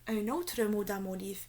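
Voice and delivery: plain reading voice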